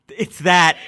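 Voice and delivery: ordinary talk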